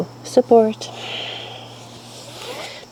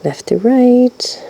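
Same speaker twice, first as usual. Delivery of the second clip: slow drawn-out cue; 6 semitones higher, much slower than usual. Shown as a demonstration. Teacher paces the movement.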